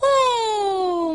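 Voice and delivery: high admiring cry; delighted oh. A narrator's reading. A csapat ámulva felkiált, elragadtatva. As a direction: gasp in wonder. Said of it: "mondták mindannyian".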